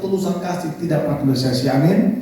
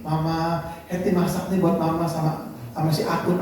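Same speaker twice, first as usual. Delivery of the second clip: softly voiced, lower daughter-in-law; retold meek offer; 3 semitones lower, 7 dB quieter than usual. Wife offers her cooking to mother-in-law, meek and soft. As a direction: offer it quietly and politely. Menantu menawarkan masakan ke mertua.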